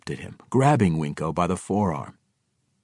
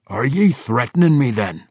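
louder gruff challenge; bristling dwarf; 7 semitones higher, 8 dB louder than usual. Acted. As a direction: take offence. Winko bristles.

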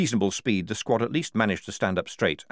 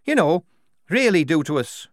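high, anxious question; worried prisoner's voice; nervous; 9 semitones higher, 5 dB louder than usual. A narrator's reading. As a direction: ask it nervously, pitched up and louder. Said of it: "said Shufti nervously".

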